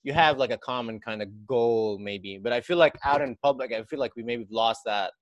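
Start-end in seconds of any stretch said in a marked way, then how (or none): none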